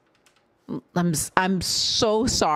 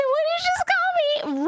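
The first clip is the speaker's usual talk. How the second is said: much higher, mock indignant voice; mock offended viewer; faster than usual. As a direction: play the offended viewer, hammed-up. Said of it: "And you said".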